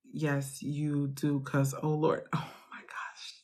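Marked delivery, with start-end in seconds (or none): 2.3-3.3 breathy whispered aside, much softer. Real, not acted. Coach whispering to the live chat, half laughing. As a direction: whisper it like a secret, stifling a laugh.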